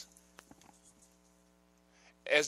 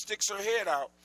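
usual speaking voice

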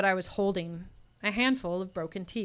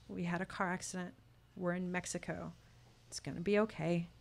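quiet, tearful; 7 dB quieter than usual. A narrator's reading. Narrator answering, holding back tears.

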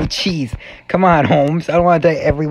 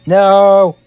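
loud, raised drawn-out cry; dismayed shout; 5 semitones higher, 9 dB louder than usual. Real, not acted. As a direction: cry out at losing the run. Loses the game again.

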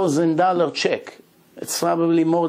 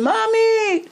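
high, loud joyful cry; screamed Mommy; 3 dB louder than usual. Retold or imitated. Boy sees his lost mother and screams for her. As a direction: call out to a mother thought dead. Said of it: "he screamed".

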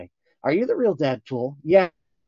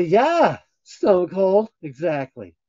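ordinary talk, nothing marked

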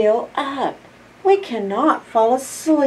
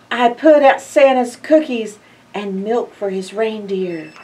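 ordinary delivery

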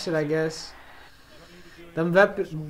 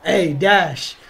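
ordinary delivery

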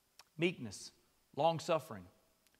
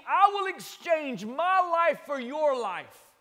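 loud, high, impassioned preaching; rising sermon fervour; preaching at full force; 9 semitones higher, 8 dB louder, slower than usual. Acted. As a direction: preach it out with fire.

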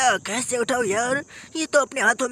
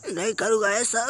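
ordinary delivery

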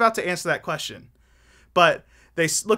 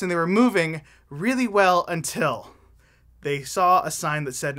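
normal talking voice

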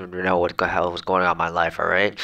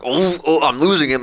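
higher, louder worried yelp; mock panic; 8 semitones higher, 5 dB louder than usual. Salesman losing a lead on a cold call, mock panic. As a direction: act out a salesman's sudden alarm.

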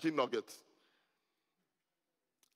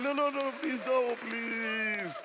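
high, raised-voice pleading; urgent protest; 8 semitones higher, slower than usual. Real, not acted. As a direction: beg them to stop, high and drawn out at the end. Stops congregation applauding his anniversary.

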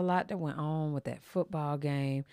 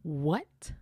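ordinary talk, nothing marked

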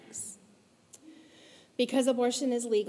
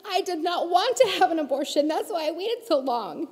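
real emotion pushing the quote louder; emotional, raised voice; 6 semitones higher, 7 dB louder than usual. Real, not acted. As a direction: let the feeling break through, louder and higher. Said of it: "I get so emotional".

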